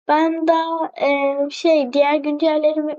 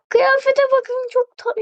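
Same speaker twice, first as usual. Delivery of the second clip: delighted gasp; 7 semitones higher than usual. Real, not acted. Excited at new outfit.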